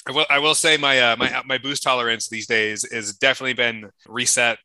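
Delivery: ordinary delivery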